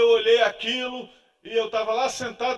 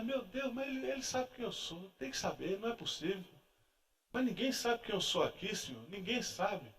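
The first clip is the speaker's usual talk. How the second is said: much softer, anxious murmur to God; hushed inner plea; 12 dB quieter than usual. Retold or imitated. Man in the pew pleading silently with God, hushed. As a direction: keep it small and hidden.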